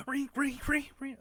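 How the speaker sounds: high, loud repeated "ring"; high-pitched mimicked clatter; imitated noise. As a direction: squeal it out in quick high bursts. Imitates carts stuck and rattling.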